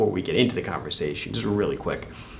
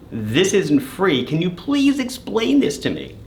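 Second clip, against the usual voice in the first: high-pitched, drawn-out put-on plea; hammed-up appeal for an explanation; mock pleading protest; 9 semitones higher than usual. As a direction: play the baffled victim, slow then climbing high. Acted protest to scammer over payment.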